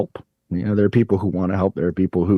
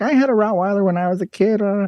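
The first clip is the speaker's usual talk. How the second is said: mocking a know-it-all teenager, pitched much higher; know-it-all teen; 14 semitones higher than usual. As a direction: sneer it like a smug sixteen-year-old. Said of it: "like, know-it-all".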